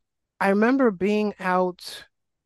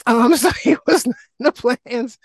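laughing; 5 semitones higher, 7 dB louder than usual. Real, not acted. Laughing about unplanned son.

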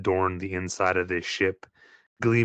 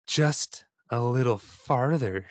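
luring Andrea; slower than usual. Acted. Andrea luring player deeper.

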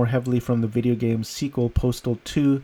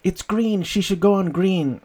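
mock irate; 7 semitones higher than usual. Cop behind a woman stopped at green, mock irate. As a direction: imitate an irate driver's complaint, pitched way up. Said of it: "behind her getting irate".

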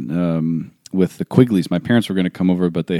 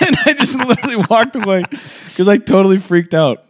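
high, laughing embarrassed retelling; 12 semitones higher than usual. Real, not acted. Telling a friend his blunder, laughing high. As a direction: laugh at your own panic.

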